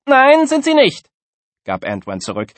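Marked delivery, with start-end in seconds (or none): 0.1-1.1 very high, loud contradiction; squabbling schoolkid denying it; defiant retort. A narrator's reading. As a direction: fire back loudly, voice pitched way up.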